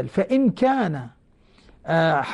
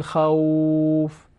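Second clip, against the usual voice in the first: recited example; much slower than usual. Shown as a demonstration. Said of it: "لاحظوا".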